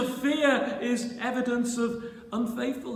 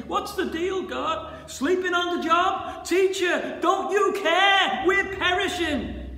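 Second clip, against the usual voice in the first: high, loud challenge to God; indignant, raised-voice cry; accusing plea; 7 semitones higher, 4 dB louder than usual. Acted. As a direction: demand an answer, building to a shout. Voices believers' angry plea to God.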